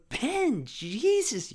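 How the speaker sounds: high-pitched mock-shocked mother; exasperated mom. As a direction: scold the kid in amused disbelief. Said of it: "You can see her laughing".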